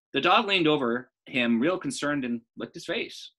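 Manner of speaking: put-on lively storytelling voice for children; story narration. Teacher reading aloud to class, lively narration. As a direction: read it like a bedtime story.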